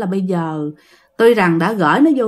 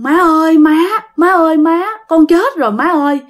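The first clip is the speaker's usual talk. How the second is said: high, acted wail to mother without real sobs; mock crying out; 7 semitones higher, 4 dB louder than usual. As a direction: imitate a son wailing, pitched up and pressing. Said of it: "khóc quá trời khóc luôn".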